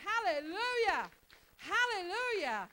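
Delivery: loud, slow exultant hallelujah; real praise after preaching; joyful hallelujah. Real, not acted. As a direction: stretch it out in joy. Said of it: "I preached me happy".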